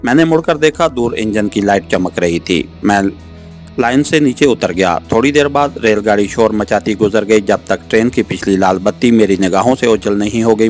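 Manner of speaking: plain reading voice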